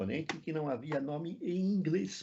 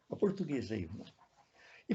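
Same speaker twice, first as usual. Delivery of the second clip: usual speaking voice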